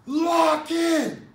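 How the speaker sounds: loud, high, arrogant shout of a guard; arrogant order. Retold or imitated. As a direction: bark it like a guard who looks down on you. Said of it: "the arrogance and the tone in his voice".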